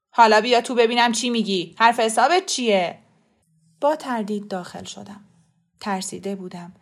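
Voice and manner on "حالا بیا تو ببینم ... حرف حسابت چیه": loud, impatient order; gruff demand. A narrator's reading. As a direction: order them inside, loud and annoyed. Flustered shopkeeper gruffly orders her in. (دستور بلند و طلبکارانه)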